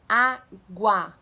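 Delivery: AGUA said loud in two drawn-out syllables; modelling for imitation. Shown as a demonstration. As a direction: stretch Água out, loud and clear. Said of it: "Abaixando a altura". (Água bem alto, sílaba por sílaba)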